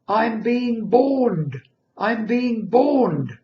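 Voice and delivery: put-on high, loud, slow baby voice. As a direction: drag it out like a newborn wailing. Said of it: "I said in this baby voice".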